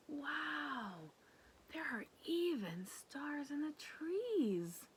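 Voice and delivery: awed whisper. Acted. Awed at stars.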